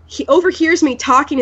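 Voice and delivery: ordinary talk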